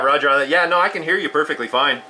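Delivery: ordinary talk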